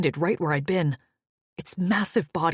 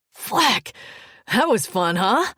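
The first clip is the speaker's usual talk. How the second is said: thrilled; 4 semitones higher, 4 dB louder, slower than usual. Acted. Maddock thrilled after the escape.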